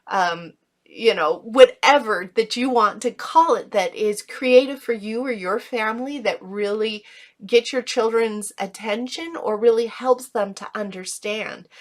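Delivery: ordinary delivery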